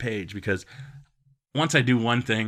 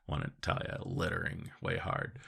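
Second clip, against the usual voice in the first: softer, conspiratorial whisper; whispered aside; 2 semitones lower, 9 dB quieter than usual. Real, not acted. Comic telling the audience an aside, in a whisper. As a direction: lean in and whisper it.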